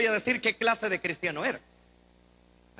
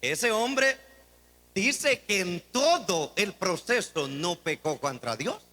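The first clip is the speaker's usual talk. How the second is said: normal talking voice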